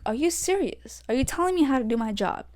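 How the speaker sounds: high-pitched, incredulous retort; irritated disbelief. Retold or imitated. Ref answering a player who questions her authority, irritated disbelief. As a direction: challenge her, voice jumping up in irritation. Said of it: "it irritated me".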